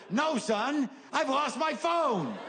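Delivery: a hint of a high old man voice, slow on the last line; lightly voiced bewildered old man. Old man answers helper.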